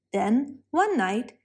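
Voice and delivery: usual speaking voice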